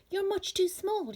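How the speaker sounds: mocking friends. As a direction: sneer it down at the tiny bird. Friends mocking the small bird.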